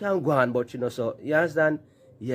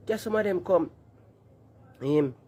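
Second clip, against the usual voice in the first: usual speaking voice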